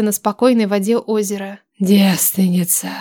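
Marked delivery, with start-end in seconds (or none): 1.8-2.9 low, slow husky werewolf growl; hoarse werewolf voice. Acted. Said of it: "услышала хриплый и будоражащий голос оборотня".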